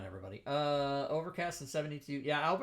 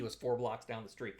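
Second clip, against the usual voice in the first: normal talking voice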